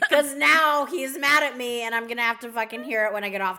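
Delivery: loud, exasperated retelling with a laugh; mock annoyed mom. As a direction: complain like a fed-up mother, played for laughs. Retells mom's annoyed reply on phone.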